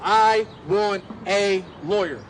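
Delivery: slow, each syllable spelled out; firm and deliberate, syllable by syllable; every syllable stressed. Retold or imitated. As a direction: lay down every syllable of "I want a lawyer". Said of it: "every syllable".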